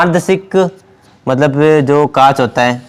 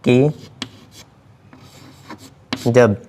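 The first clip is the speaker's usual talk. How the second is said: word by word, long pauses; slow dictation; 3 semitones lower, 6 dB quieter, slower than usual. Real, not acted. Teacher dictating a physics note to students, slow dictation. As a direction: dictate slowly while writing it out. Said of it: "इसमें हम लिखेंगे".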